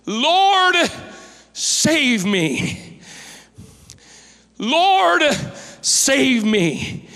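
sudden high cry on Lord, then falling back. A narrator's reading. A sinking man's high cry to the Lord. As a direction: Peter sinking, crying to the Lord for help. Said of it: "He cried, saying".

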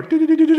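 high, quick mock radar blips; radar beep. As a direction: beep like a scanner homing in. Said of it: "like a radar".

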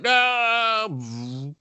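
high, put-on flat no; mock refusal. Devil refusing Slimer's plea, high mock no. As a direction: refuse in a silly voice.

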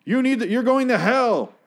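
high, slow, loud mock berating; hammed-up condemnation. Acted stranger on a plane damning his seatmate, high mock shout. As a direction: shout damnation at the sinner. Stranger turns on him about salvation.

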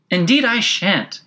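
high, indignant, drawn-out refusal; rather impatient. A narrator's reading. Alice refusing the gentleman's advice, rather impatient. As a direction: pitch it up and dig in. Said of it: "Alice said rather impatiently".

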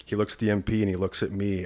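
normal talking voice

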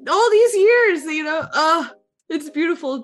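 real emotional swell; tearful gush. Real, not acted. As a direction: gush it like you're about to sob. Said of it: "It actually hurts me".

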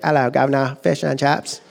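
mock-British cabbie greeting; cheeky British accent. Preacher imitating a cheeky cab driver, in a British accent. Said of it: "cab driver is being all cheeky".

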